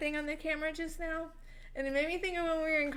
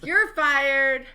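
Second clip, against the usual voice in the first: mock firing; 10 dB louder than usual.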